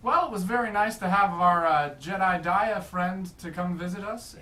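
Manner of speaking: loud, bright host announcement; talk show host. Acted.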